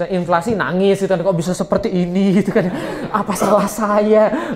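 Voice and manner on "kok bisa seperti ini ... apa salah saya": mock-tearful whine, stretched out very slowly with a laugh; mock crying leader. Preacher mocks a female leader crying over inflation, half-laughing whine. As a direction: lightly ham up a weepy female leader. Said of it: "belum apa-apa nangis gitu".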